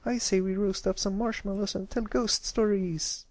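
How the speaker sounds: high eager filly voice. Acted. Scootaloo proposing ghost stories, eager. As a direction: pitch the fun idea.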